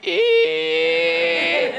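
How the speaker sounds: high sung shriek; imagined screech. Retold or imitated. Speaker imagines Mary Lincoln's outburst, high mock screech. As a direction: let out a high piercing "Ee". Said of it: "I can imagine it went like".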